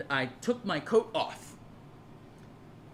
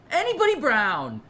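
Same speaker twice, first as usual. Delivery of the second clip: mock lecture; 8 semitones higher, 7 dB louder than usual.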